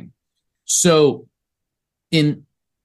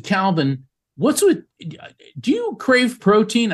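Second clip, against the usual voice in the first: eager question; 9 semitones higher than usual. Retold or imitated. Calling Calvin with urgent question.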